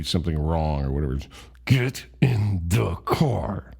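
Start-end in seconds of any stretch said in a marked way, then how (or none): 1.6-3.7 very slow, hushed order through clenched teeth; clenched-teeth anger. Retold or imitated. Mad mom ordering her son into the car, through clenched teeth. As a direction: whisper it tightly, teeth locked, as a mad mom. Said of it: "clenching her teeth whenever she was mad".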